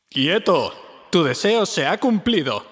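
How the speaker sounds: put-on a touch of a commanding old wizard; slow, stern command from hiding; lightly voiced Gandalf revealing himself. A narrator's reading. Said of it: "dijo una voz".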